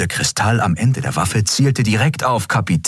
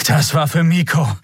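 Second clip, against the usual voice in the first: high, strained out-of-breath line; a touch winded after the fight; breathing heavily; 8 semitones higher than usual. A narrator's reading. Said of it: "erklärte Kai schwer atmend". (leicht außer Atem, mit angehobener Stimme)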